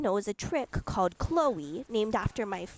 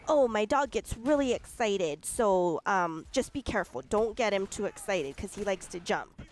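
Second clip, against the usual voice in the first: ordinary talk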